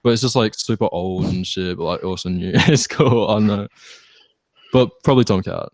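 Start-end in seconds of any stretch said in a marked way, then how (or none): none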